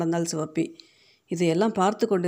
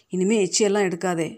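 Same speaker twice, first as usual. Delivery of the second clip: ordinary delivery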